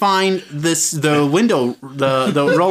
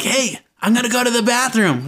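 higher-pitched retold call to a friend; 7 semitones higher than usual. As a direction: call it over to the passenger without really yelling. Calling to his friend in the story.

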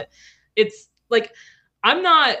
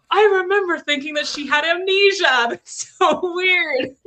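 laughing; 9 semitones higher than usual. Real, not acted. Laughing at own memory.

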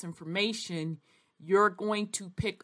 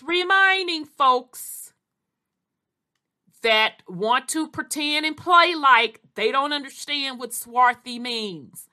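pointed, emphatic; 5 semitones higher, 6 dB louder than usual. Real, not acted. Lecturing those who play dumb.

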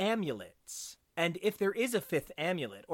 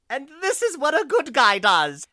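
loud, high hammed-up proclamation; Jamie impression; 8 semitones higher, 11 dB louder than usual. Imitating how Jamie would talk, loudly hammed-up. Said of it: "the way Jamie would kind of be like".

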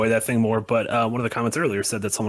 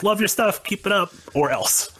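high mock Cockney threat; Cockney accent; 10 semitones higher than usual. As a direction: play up the Cockney sign-off. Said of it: "I love how it ended with".

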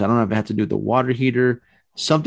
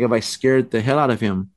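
ordinary delivery

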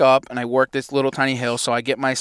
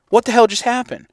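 higher, sharper second asking; exasperated repeat; 7 semitones higher than usual. Retold or imitated. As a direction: throw up your hands and ask again. Repeating the same disbelief.

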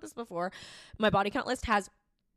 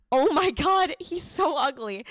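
higher and louder, slowed on "so ugly"; loud horrified; 5 semitones higher, 6 dB louder, slower than usual. Retold or imitated. Recalling the ugly date, loud horrified cry. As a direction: exclaim in horror. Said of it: "But I'm like".

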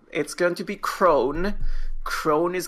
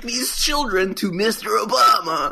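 higher mock-heroic plea, half laughing; hammed-up dramatic plea; 6 semitones higher than usual. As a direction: declare it as a silly urgent rescue. Acts out the absurd prediction.

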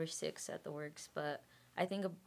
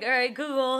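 excited call to phone; 8 semitones higher, 12 dB louder than usual. Retold or imitated. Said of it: "So then I was like".

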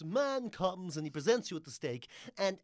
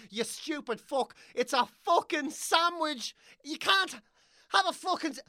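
usual speaking voice

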